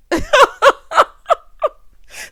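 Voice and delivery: squealing burst of laughter; high shrieking laugh. Real, not acted. Viewer laughing at turn your head, shrieking.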